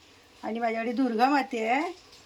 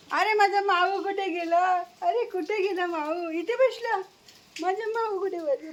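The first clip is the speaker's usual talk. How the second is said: high, sing-song coo to a pet; playful pet talk; 6 semitones higher than usual. Real, not acted. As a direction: pretend to hunt for your darling.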